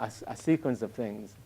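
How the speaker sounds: normal talking voice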